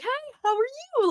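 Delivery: high, drawn-in happy greeting, imitated; imitated cheery, high-pitched hello; boisterous and happy. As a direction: greet them like a delighted old friend. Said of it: "so boisterous and happy".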